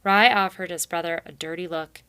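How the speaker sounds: ordinary delivery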